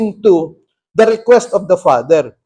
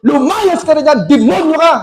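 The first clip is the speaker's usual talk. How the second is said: raised, high exorcist shout; retold shouted command; 6 semitones higher, 5 dB louder than usual. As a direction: shout the demon out, louder and higher. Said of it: "Nung sinigawan ko". (pasigaw na pagpapalayas sa demonyo)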